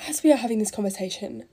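ordinary talk

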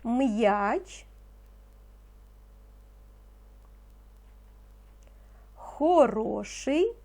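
teacher dictating single words, slow and distinct; slow dictation. Acted. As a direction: give each word slowly so children can write. (повільний диктант учителя)

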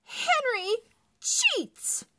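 high, loud furious accusation; angry yell. Acted. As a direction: yell it like a sore loser. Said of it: "that she yelled".

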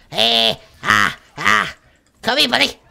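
loud, excited yells; shouting in combat. Real, not acted. Streamer chasing an enemy with a bat, loud shouts. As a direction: yell after a fleeing target.

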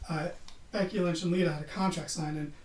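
ordinary talk, nothing marked

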